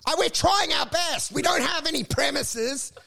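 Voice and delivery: mock desperate pleading. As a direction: plead like a broke podcaster begging for help. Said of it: "I'm like".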